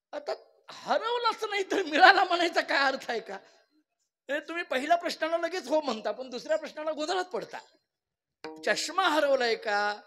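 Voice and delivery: loud, high kirtan preaching; a preacher rousing village elders, loud and high; fervent preaching. Acted. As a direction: drive the point home to the crowd. Kirtan preaching at full force.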